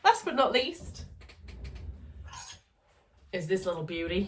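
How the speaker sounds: hammed-up Australian accent; jokey imitation Australian voice; fake Australian accent. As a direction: put on a playful, fake Aussie accent. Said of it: "a fake Australian accent".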